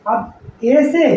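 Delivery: normal talking voice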